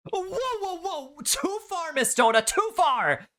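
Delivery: put-on high, panicked girl's protest; teen girl squealing in panic under the mistletoe; panicked objection. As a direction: squeal it out in alarm. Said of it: "she panically objected".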